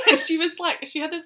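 talking through loud laughter; laughing. Real, not acted. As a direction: laugh as you tell it. Laughing while retelling the scene.

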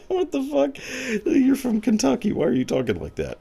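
incredulous reaction. Retold or imitated. Retelling her reaction to Depp's odd speech, high incredulous squeal. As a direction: squeak out your amazement. Retold own amused disbelief.

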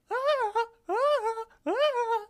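high, loud repeated "ah" cries; imitated weird sound. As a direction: belt out short, high-pitched straining wails. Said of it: "he gives this weird sound".

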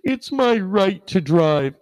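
put-on slow whine of entitlement; mocking entitled driver. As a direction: mimic an entitled complainer, slow and high. Mocking entitled drivers, then rebutting them.

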